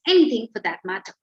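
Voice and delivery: ordinary delivery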